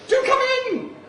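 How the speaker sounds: high, loud flamboyant welcome; camp shrieked greeting. Acted. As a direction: squeal it like a grand hostess. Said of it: "That's my way of saying hello".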